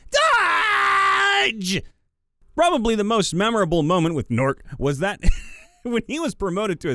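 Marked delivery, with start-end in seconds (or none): 0.1-1.8 high, loud goblin yell. Retold or imitated. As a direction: bellow it as you hurl a rock. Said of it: "while yelling".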